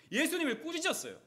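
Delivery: ordinary talk, nothing marked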